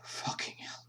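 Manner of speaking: under the breath, voiceless; whispered curse. Real, not acted. The game master swears under his breath in a whisper. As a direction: hiss it to yourself. Muttered aside.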